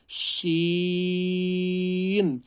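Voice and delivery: شین held long, chanted high and loud; teacher chanting a long شین; stretched recitation. Shown as a demonstration. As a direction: draw out شین in recitation style. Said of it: "اس کو بھی کھینچنا ہے".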